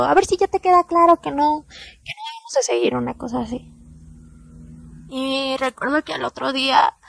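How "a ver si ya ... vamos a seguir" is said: boyfriend's yell replayed, high and loud; retold yell. Boyfriend shoving her onto the bed, shouting. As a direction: bark it at her like the angry boyfriend. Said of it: "y me gritó".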